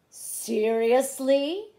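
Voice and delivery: loud, drawn-out incredulous question; exasperated disbelief. A narrator's reading. Theodore fed up with the roaring boy, loud disbelief. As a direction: stretch it out loudly, rising at the end.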